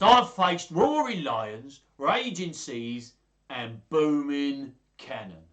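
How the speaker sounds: slow, loud, booming boast; hammed-up bravado, drawn out; bragging. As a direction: thump it out like a swaggering hero. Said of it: "he bragged".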